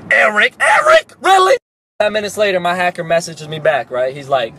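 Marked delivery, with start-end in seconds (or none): 0.1-1.6 high, loud yell of outrage; disbelieving shout. Retold or imitated. Yelling at the phone about the hacker, outraged shout. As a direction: shout the name twice in disbelief.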